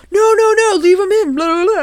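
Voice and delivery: high, loud pleading son; Josh's voice. Retold or imitated. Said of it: "And he's like".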